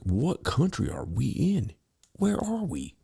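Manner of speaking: slow, high disbelief, pitch jumping up; incredulous. Retold or imitated. Narrator stunned at airport checkpoint, high slow disbelief. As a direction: act stunned at the chaos. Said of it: "I was like".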